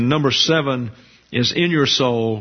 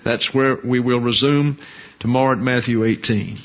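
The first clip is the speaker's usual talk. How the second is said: ordinary talk, nothing marked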